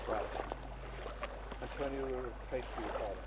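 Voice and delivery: ordinary talk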